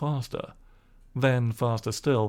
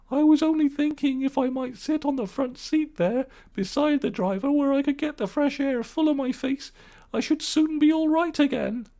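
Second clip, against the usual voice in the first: put-on high, sweetly wheedling old woman; washerwoman's voice. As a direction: coax your way to the front seat. Toad as washerwoman.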